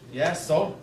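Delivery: low, soft, offhand comeback; smart-ass retort. Retold or imitated. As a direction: imitate a cheeky son brushing dad off. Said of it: "me being a smart ass".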